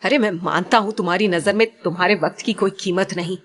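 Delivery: plain reading voice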